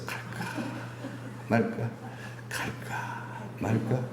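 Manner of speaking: hushed, slow inner debate; whispered dithering. Pretend. Churchgoer dithering at home before service, whispered. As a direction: whisper the doubt to yourself slowly.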